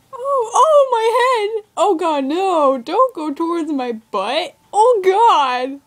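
high, loud wailing protest; horrified outcry. Real, not acted. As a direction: cry out in drawn-out horror at the gore. Horrified at severed body parts in game.

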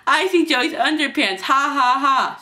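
high, loud taunt with a mock laugh; tease, then fake a loud ha-ha; jeering. Kids jeer and laugh.

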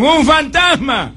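slow, high, loud scream of fear; frightened cry. Retold or imitated. Disciples cry out at Jesus on the water, frightened shout. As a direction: cry out terrified at the sight. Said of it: "que gritaron".

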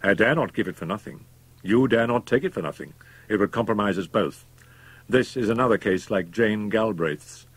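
emphatic, business-like persuasion; cool accomplice, pressing. A narrator's reading. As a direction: press the point firmly.

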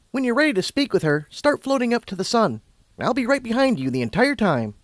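high-pitched encouraging guide; Twilight, reassuring. A narrator's reading. Twilight sending Lumina up to the sun, reassuring. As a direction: encourage her in a high character voice.

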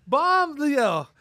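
usual speaking voice

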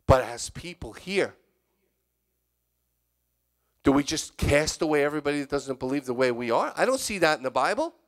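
ordinary talk, nothing marked

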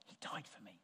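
near-silent breathy murmur; a hushed inward prayer; under your breath. Shown as a demonstration. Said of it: "just under your breath".

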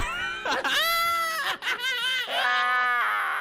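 shrieking high laugh; howling laugh. Real, not acted. Bursts out after headshot quote.

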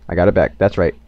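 normal talking voice

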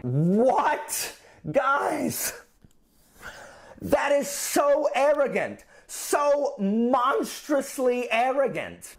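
high, slow, incredulous outrage; appalled disbelief. Real, not acted. Teacher aghast at Britain's demand for apology, appalled. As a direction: stretch the words out, aghast, pitch high.

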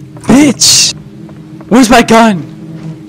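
scared horror game streamer. Real, not acted.

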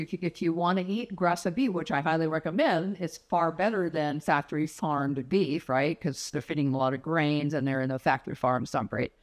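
ordinary talk, nothing marked

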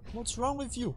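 high-pitched mock protest; mock-indignant question. Scolds an exploding tick in game.

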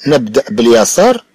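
normal talking voice

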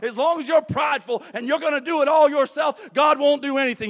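loud, high, rousing preacher's call; full-force preaching. Acted. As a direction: preach it out to the congregation.

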